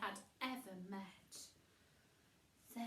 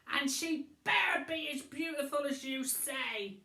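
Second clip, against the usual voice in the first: calling after; 4 semitones higher, 8 dB louder than usual. Acted. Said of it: "Billy called after him".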